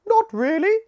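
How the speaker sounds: high, loud, out-of-breath bee voice; a frazzled queen puffing out her answer; weary queen bee. Acted. Tired queen bee answering Scout, high and panting.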